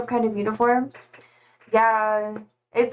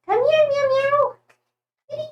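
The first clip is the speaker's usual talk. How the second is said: cat call. Real, not acted. Streamer coaxes her cat to the mic, high cooing. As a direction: coo sweetly for the kitty.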